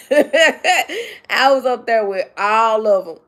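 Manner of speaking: slow words through a laugh; chuckling proudly. Real, not acted. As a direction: laugh while you say it. Laughing while repeating.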